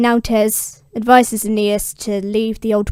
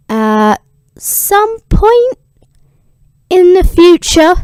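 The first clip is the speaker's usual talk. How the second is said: sing-song drawl; 8 semitones higher, 7 dB louder, slower than usual. Real, not acted. Playful vague sign-off.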